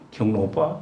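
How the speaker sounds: put-on a cute, coaxing girlfriend calling her boyfriend. Shown as a demonstration. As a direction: coo it like a sweet young girlfriend. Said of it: "막 이렇게".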